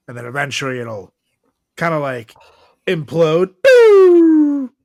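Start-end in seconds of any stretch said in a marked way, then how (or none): 3.6-4.7 loud, high mock "boo" for the sun imploding; imploding sound effect. Shown as a demonstration. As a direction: blurt it out like a cartoon explosion.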